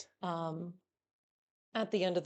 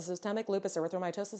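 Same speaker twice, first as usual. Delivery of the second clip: quick, softer rattle through the term; fast, quieter tongue-twister repeat; 2 semitones lower, 5 dB quieter, faster than usual. Shown as a demonstration. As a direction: race through it without a break. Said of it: "Say that three times fast".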